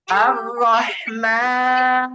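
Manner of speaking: "রহমান" drawn very long, air flowing on the h; airy ha demo. Shown as a demonstration. Teacher tells class to test the airflow, slow recited demonstration. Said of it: "test করেন এইভাবে".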